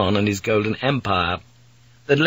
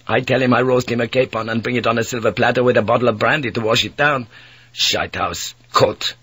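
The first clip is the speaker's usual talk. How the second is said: bitter Dutch mate. Acted. Mate scorning the Captain General, fast bitter Dutch voice. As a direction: spit out the mockery in a rush.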